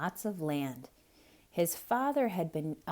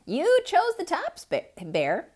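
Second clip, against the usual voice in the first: much higher, sing-song hare voice; a sly hare reminding Bear of his choice; high, innocent hare; 12 semitones higher, 7 dB louder than usual. Acted. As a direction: play innocent and point it out. Hare answers.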